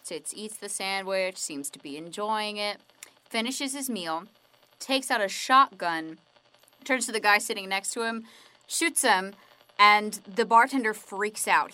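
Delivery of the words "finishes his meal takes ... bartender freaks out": normal talking voice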